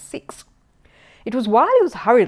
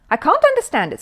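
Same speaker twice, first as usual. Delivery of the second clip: baffled; 9 semitones higher, 6 dB louder than usual. Acted. Mr Brown puzzled at broken set.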